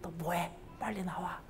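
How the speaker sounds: hushed whispered nudge. Acted. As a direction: whisper it so no one hears. Voiced sister pinching quietly.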